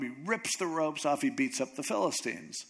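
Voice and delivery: normal talking voice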